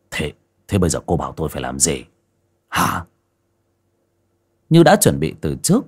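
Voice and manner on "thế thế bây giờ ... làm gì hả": low, fast flustered question; panicky husband. Acted. Hoàn asks his wife what to do, low and rushed.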